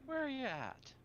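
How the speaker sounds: teasing, bored vampire voice; sultry vampire. Acted. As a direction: purr it out, mocking the prude. Vampire demands location.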